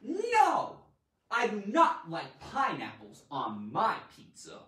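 stamp the claim with No; emphatic no. Shown as a demonstration. Teacher stressing a claim about pineapple pizza. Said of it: "Emphasize your claim".

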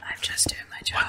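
breathy sinister whisper, barely voiced; creepy whisper. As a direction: whisper like a menacing minder. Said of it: "whisper voice is creepy".

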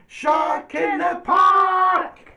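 high, long, drawn-out holler; storybook shout. Acted. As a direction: yell the alarm slowly at full voice. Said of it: "he shouts".

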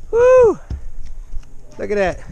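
usual speaking voice

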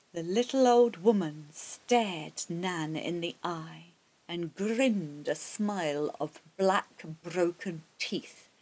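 usual speaking voice

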